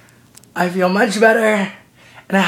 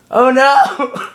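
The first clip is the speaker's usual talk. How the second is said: mock alarm; 5 semitones higher, 4 dB louder than usual. Mock alarm at sounding ill.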